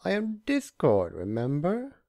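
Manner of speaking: theatrical high boast; showy Discord. A narrator's reading. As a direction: boast like a chaos spirit. Discord voiced, pitched up.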